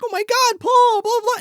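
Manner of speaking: very high, loud hammed-up shriek; mock panic. Woman finding Paul, mock panicked cry. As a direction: imitate her panicking, high and tossed off. She reacts to seeing Paul.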